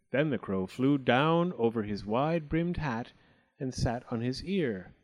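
usual speaking voice

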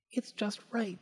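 put-on high, soft little-girl voice; contented girl. A narrator's reading. Goldilocks trying the little chair, softly content.

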